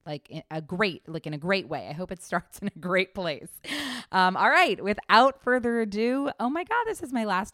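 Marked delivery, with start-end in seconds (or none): 4.1-7.5 loud, high, pumped intro call; excited kickoff. Real, not acted. Host hyping listeners before the year recap, loud and excited. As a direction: launch the show with a burst of energy.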